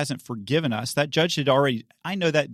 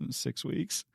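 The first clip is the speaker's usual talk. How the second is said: much softer, small sheepish answer; sheepish reply; 11 dB quieter than usual. Retold or imitated. Teen to stern judge, meek and much softer. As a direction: mumble it like a scared kid.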